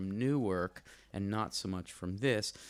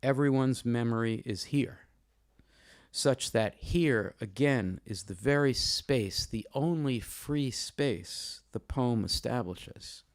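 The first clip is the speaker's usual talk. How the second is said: formal literary reading; 6 dB louder than usual. Real, not acted. Host reading a review to introduce the poet, formal emphatic reading. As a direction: read it out deliberately, with weighted key words and pauses.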